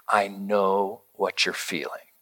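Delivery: soft, slow, quiet reassurance; slow down and soothe; tender comfort. Retold or imitated. Father comforting son.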